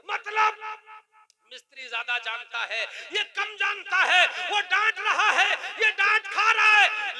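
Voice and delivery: high, very loud hammering sermon; thundering preacher's argument; full-force preaching. Real, not acted. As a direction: drive each clause home to the congregation, shouting.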